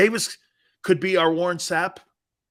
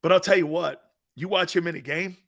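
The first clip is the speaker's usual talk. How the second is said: ordinary talk, nothing marked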